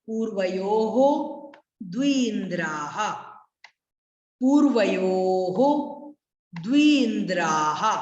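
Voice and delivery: slow, drawn-out पूर्वयोः द्विन्द्राः with echoed final ह; careful Sanskrit recitation. Shown as a demonstration. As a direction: stretch the long vowels of पूर्वयोः द्विन्द्राः, sound each visarga. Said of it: "कैसे पढ़ना है ये". (पूर्वयोः द्विन्द्राः धीरे-धीरे, विसर्ग के साथ खींचकर)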